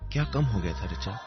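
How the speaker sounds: usual speaking voice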